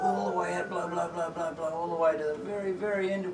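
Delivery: high, urgent coaching chant; blowing out hard. Real, not acted. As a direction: cheer them on to blow everything out. Clinician urges patient through spirometry.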